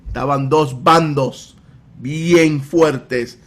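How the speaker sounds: ordinary talk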